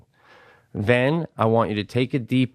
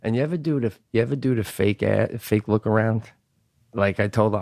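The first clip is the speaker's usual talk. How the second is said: ordinary talk, nothing marked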